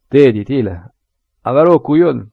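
P=A; rough hearty call. Acted. Morin urges son to eat.

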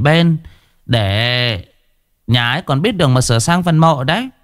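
high, bragging shaman voice; boastful shaman. A narrator's reading. Shaman boasts to the couple in the car, bragging high voice. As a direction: show off your powers to the couple. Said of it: "ba hoa hết chuyện trên trời".

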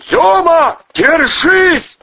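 high, full-throated holler; yelling. A narrator's reading. Vovchik outshouts the storm to his friend, yelling. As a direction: shout to be heard over bad weather. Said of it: "завопил Вовчик".

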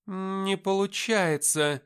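ordinary delivery, nothing marked

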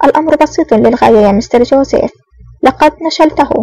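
ordinary delivery, nothing marked

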